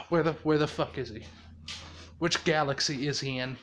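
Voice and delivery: low, soft grumble after a sigh; weary irritation. Retold or imitated. Izuku wondering where Thanos went, low and exasperated. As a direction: mutter it low, fed up. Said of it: "And it's like".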